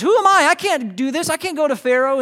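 high, loud, overwhelmed objection; panicky protest. Acted. Moses objects to God about facing Pharaoh, panicky.

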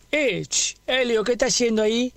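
challenging a stranger scratching his car; confronting challenge. Retold or imitated.

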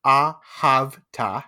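very slow "ve'ahavta", syllables separated; patient teacher spelling out "ve'ahavta"; staccato. Shown as a demonstration. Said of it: "We'll do one word at a time".

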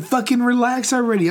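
high, exasperated quote from an argument; retold exasperation. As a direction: re-enact losing patience with a partner. Re-enacting quarrel with partner.

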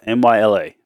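ordinary talk, nothing marked

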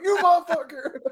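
high laughing yell of a startled boot; mock angry yell. Boot startled by a hazer, yelling through laughter. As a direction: yelp it out mid-laugh, half joking. Hazing story, boot yells.

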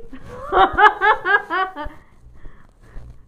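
high, loud burst of laughter; long cackling laugh that tails off; real big laugh. Real, not acted. Laughs after acted outburst.

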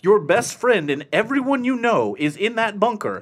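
exasperated rant. Retold or imitated. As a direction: build a frustrated lecture to the screen. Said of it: "I'm like".

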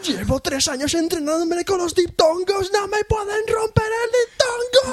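high, slow hammed-up wail; mock despair. Co-host wails in mock despair over diphthongs, high and slow. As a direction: play a broken-hearted athlete.

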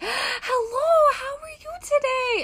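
very high, chirpy Minnesota greeting; imitated Minnesota accent. Maintenance lady greeting her in the hallway, imitated Minnesota accent. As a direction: put on a high-pitched Minnesota hello. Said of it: "I don't know what accent it is".

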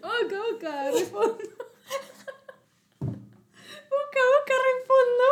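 high, helpless laughing speech; crying with laughter. Real, not acted. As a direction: squeeze the words out through tearful laughter. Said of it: "Me siento llorar". (llorando de risa, voz aguda)

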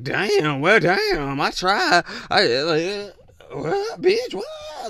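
imitating an exasperated woman; mock exasperated woman. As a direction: voice jumps way up on "what, bitch, what". Mocking a woman's reaction.

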